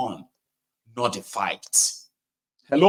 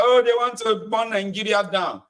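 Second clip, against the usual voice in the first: higher-pitched mock scream of alarm; mock alarmed cry; 9 semitones higher than usual. Host mimicking politicians scaring people off protest, mock alarmed cry. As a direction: imitate scaremongers crying out, without real shouting. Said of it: "the politicians are screaming that".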